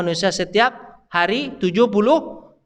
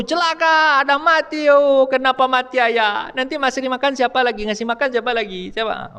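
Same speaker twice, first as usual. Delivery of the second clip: wailing mourner; 9 semitones higher than usual. Retold or imitated. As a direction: cry out in grief for the dead father. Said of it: "ada yang berseru". (ratapan keluarga yang berduka, suara tinggi)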